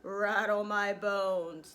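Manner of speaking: slow, loud ghostly drawl; a parrot doing a spooky voice, drawn right out. Acted. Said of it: "giving a wonderful imitation of a spooky voice".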